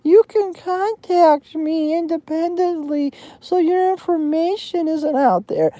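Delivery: high, soft put-on voice for a mocking quote; mocking Jeremy. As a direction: raise the pitch and softly mimic his plea. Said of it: "He, he says, and I quote".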